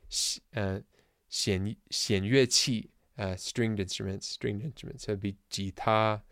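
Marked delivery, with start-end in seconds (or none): 0.1-2.9 slow, careful Mandarin "xian yue qi"; teacher modeling "xian yue qi" slowly; Mandarin term. Shown as a demonstration. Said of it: "So they'd say".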